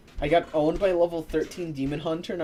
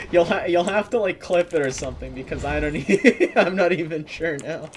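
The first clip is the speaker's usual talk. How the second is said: laughing through words; 4 semitones higher, 6 dB louder than usual. Real, not acted.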